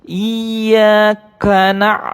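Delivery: slow chanted tilawah; Quran recitation. Shown as a demonstration. Teacher chants the verse for pupils to repeat. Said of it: "Anak-anak ya".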